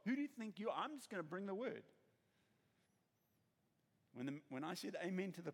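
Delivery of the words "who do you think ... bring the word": defiant. Retold or imitated. Retold defiant woman's thoughts.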